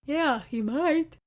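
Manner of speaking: slow, said through a yawn. Acted. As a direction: stretch it out mid-yawn. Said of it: "he yawned".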